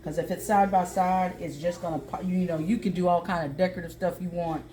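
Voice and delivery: ordinary talk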